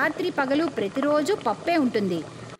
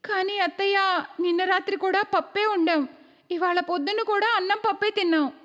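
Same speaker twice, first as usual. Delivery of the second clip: put-on higher-pitched young wife's complaint; protesting daughter-in-law; 4 semitones higher than usual. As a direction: plead with your mother-in-law, pitched up. (హెచ్చు స్వరంలో కోడలి ఫిర్యాదు)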